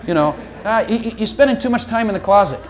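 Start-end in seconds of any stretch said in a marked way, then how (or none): none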